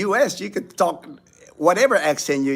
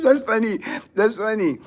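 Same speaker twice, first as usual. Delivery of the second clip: amused; 8 semitones higher than usual. Real, not acted. Still amused by the question.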